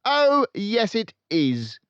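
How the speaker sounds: loud, high bullying shout. A narrator's reading. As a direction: shout her down. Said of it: "shouted Miss Trunchbull".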